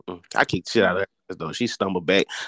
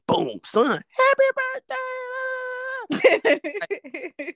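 birthday shout; much slower than usual. Retold or imitated. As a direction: shout happy birthday like a mom barging in to wake him. Mom bursts in with birthday surprise.

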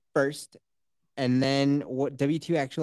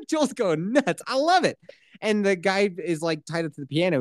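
ordinary talk

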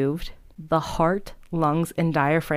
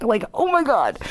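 mock gasp of disbelief, higher; shocked; 7 semitones higher, 4 dB louder than usual. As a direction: pitch jumps up in mock shock. Researchers shocked by subjects' reply.

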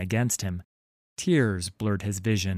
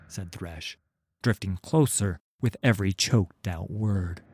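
plain reading voice

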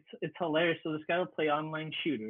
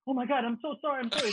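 higher, pleading mock apology; panicked apology; 7 semitones higher, 3 dB louder than usual. Storyteller mimicking a caught trash-talker, mock panicked apology. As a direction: imitate a rattled player begging forgiveness. Frightened gamer begging the hacker forgiveness.

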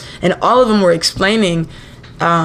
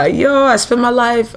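put-on Rasta accent, barely started; 6 semitones higher, slower than usual. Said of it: "I'm not gonna try to do the accent".

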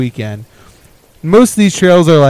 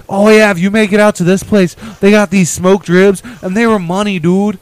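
put-on higher-pitched Canadian northern accent; thick Canadian accent; 7 semitones higher than usual. Retelling a local guy's hot-tub talk in a Canadian accent. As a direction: imitate an excitable Canadian snowmobiler. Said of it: "thick Canadian-ish or northern-ish".

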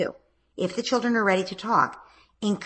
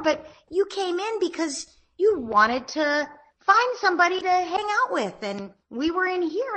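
gentle parent voice; 7 semitones higher than usual. Shown as a demonstration. Mom gently describing both kids' feelings in a raised, sweet voice. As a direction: speak up high and sweet, like soothing two kids. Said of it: "So you'd say".

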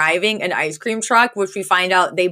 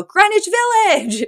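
high excited exclamation in unison; excited joint shout. Retold or imitated. Retelling a sitcom scene, two women shout together, excited. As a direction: yell it out like a quiz answer. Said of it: "they both go".

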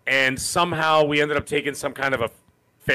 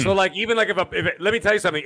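usual speaking voice

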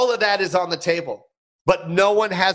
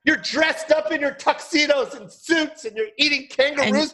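high, appalled comic rant; mock outrage; 8 semitones higher than usual. Comic scolding tuxedoed diners eating kangaroo, mock outrage. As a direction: scold them in a high, hammed-up voice.

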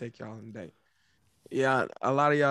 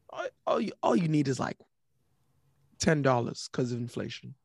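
ordinary talk, nothing marked

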